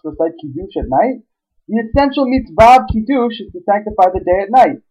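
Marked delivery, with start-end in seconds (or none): 1.7-4.8 excited explaining. Real, not acted.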